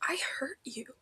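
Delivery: whisper. A narrator's reading. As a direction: breathe the words, sobered. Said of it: "he whispers".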